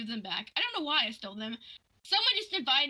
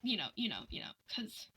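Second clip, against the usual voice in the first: hushed aside under the breath; whispering the aside; 5 dB quieter, faster than usual. Real, not acted. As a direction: drop to a whisper. Whispered aside mid-story.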